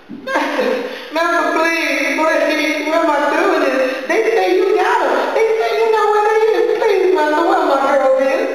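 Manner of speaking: put-on mother caterwauling at the master for her girls; desperate wailing plea. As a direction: wail at him, begging harder each time. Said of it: "all this caterwauling".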